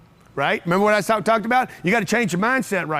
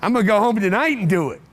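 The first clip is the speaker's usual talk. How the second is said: normal talking voice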